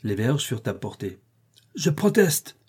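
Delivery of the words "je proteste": a much higher cry of protest; outraged protest. Acted. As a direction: cry out your protest, scandalized. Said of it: "s'écria le coq". (protestation criée, voix montée très haut)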